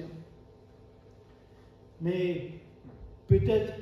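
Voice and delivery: ordinary talk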